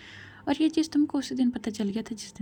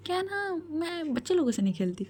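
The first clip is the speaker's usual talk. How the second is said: high-pitched playful put-down; acted haughty little taunt; teasing dismissal; 7 semitones higher than usual. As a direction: tease her, pitched up at the start. Teasing comeback to a challenge.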